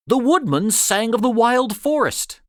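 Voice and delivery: usual speaking voice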